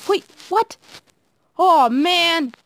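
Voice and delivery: high, startled reaction to a setback; dismayed surprise. Real, not acted. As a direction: jump up high, then groan in dismay. Sudden setback in the game.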